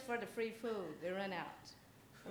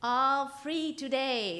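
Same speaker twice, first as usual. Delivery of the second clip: high, slow, sing-song lure of a creepy stranger; creepy candy-man call, higher and slower; weird creepy voice; 6 semitones higher, 7 dB louder, much slower than usual. Acted. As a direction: drag it out sweetly, like bait for children. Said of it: "It's kind of a weird, creepy voice".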